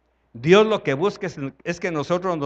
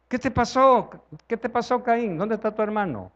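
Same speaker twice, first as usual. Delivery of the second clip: God asking Cain; 5 semitones higher than usual. Acted. Voz de Dios a Caín.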